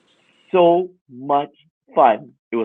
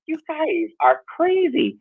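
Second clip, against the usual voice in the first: incredulous; 3 dB quieter, slower than usual. Retold or imitated. Muttering at store prices.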